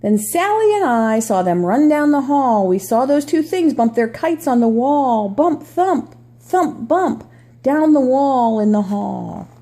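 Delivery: storybook read-aloud voice; reading to children. Acted. Reads picture book aloud.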